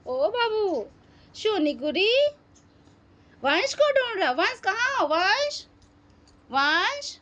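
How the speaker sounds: high sing-song calls of a name; calling out. Real, not acted. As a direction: call the name out high across the house. Calling for the lost boy.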